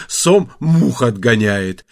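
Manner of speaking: usual speaking voice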